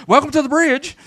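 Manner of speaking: high bright church welcome; cheery greeting. Pretend. Greeter at the church door, bright and high. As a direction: greet them gladly at the door. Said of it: "standing out there by the door".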